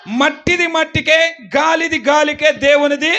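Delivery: preacher leading the congregation, loud and high; loud recital. Real, not acted. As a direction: proclaim each phrase out loud. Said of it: "గట్టిగా చెప్పండి".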